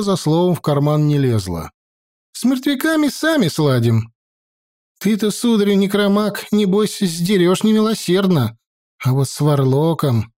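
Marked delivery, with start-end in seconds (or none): 2.3-10.3 higher, bold peasant woman voice; feisty village woman. A narrator's reading. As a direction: push back boldly, higher. Woman bargains.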